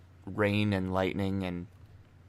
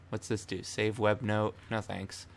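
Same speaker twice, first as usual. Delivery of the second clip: normal talking voice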